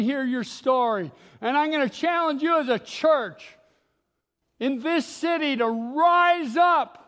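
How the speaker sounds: building to loud, high-pitched exhortation; rally the congregation with rising force; impassioned preaching. Acted.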